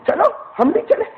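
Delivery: put-on little boy begging to come along; eight-year-old boy. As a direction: sound like an eager small boy. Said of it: "حضرت بشیر کہتے ہیں".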